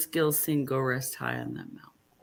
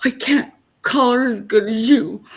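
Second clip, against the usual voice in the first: put-on higher, louder little-girl whine; little girl complaining; 7 semitones higher, 6 dB louder than usual. Mother quoting her young daughter, a light childish whine. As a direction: imitate a small daughter's sulky complaint. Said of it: "she goes".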